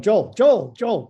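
acted-out frantic interruption; urgent calling out. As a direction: try to catch him before he hangs up. Trying to stop caller hanging up.

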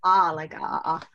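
"ah" as a long, open vowel; open vowel demo. Shown as a demonstration. Guest teaches host the vowel, demonstrating an open "ah". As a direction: sound out "ah" for the name. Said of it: "but it's [name]".

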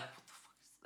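imitated whispered cursing; murmuring under breath. Scientologist muttering as she reads the manual, whispered under breath. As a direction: whisper it in mock disbelief. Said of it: "like, murmuring under her lip".

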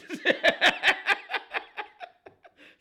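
long high cackle; high laugh. Real, not acted. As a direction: crack up laughing. Host laughs about his dancing.

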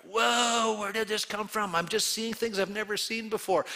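amazed wonder. Retold or imitated. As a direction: sound newly astonished. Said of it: "you're kind of going".

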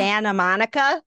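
"Santa Monica" as "Sanna Monica"; dropped T. Shown as a demonstration. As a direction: Californian "Santa Monica" without the T. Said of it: "they drop their T's".

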